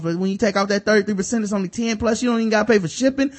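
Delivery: fast, rising to a shout; building hype. Acted. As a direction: pile on the deal, then explode. Building ad read to outburst.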